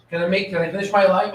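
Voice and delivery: ordinary talk, nothing marked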